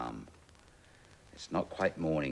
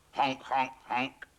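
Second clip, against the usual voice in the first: high, loud honking; goose honk; 10 semitones higher, 8 dB louder, slower than usual. Acted. Goose chasing the cat, loud honks. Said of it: "thought the goose".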